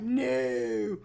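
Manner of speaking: drawn-out mock wail of protest; feelings protesting. Voicing her own feels, drawn-out mock protest. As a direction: stretch it into a small mock cry. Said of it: "My feels are like".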